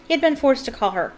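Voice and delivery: ordinary delivery, nothing marked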